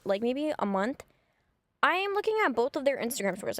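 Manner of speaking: ordinary talk, nothing marked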